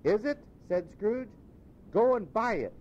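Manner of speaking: high, eager, then slowing warmly; an old man suddenly beaming at a stranger; delighted Scrooge. Acted. Scrooge asking the boy the day, delighted.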